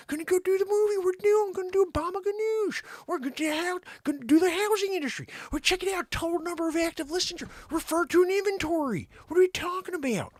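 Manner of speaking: iffy Owen Wilson; Owen Wilson impression. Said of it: "Owen Wilson wants to get involved".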